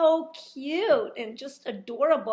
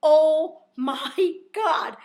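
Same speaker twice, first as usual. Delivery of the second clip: slow, drawn-out oh my God; imitating Luis; 4 semitones higher, 11 dB louder, much slower than usual. Imitating the guide's slow amazed reply. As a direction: stretch it like Luis does. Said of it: "And Luis goes".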